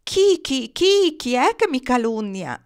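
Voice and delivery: high, loud dove retort; indignant cooing. A narrator's reading. Slandered dove answers back, indignant cooing. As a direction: coo "chi, chi" high and offended. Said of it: "tubò il colombo di rimando".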